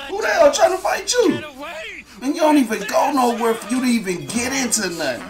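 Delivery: high fast mocking shout; scoffing. Friend scoffing at Kilo learning to fight, shouting. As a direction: ridicule him, fast.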